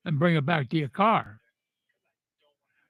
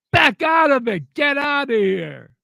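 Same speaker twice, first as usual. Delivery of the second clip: angry shout at the driver; shouted order; 6 semitones higher than usual. Retold or imitated. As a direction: yell her out of the spot. Ordering her out of the spot.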